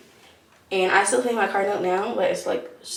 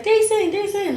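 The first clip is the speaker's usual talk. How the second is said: imitating toddler calling; 11 semitones higher than usual. Daughter calling on FaceTime.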